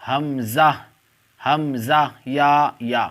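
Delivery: each letter called out loud and separate; alphabet recitation. Shown as a demonstration. Teacher calling out Urdu letters to online students, loud and deliberate. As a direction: drill the letters slowly for the class.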